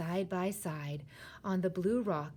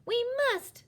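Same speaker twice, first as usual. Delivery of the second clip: high, loud insistence; high-pitched, forceful plea; urgent cry; 7 dB louder than usual. A narrator's reading. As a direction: cry it out as a demand. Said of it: "Sophie cried".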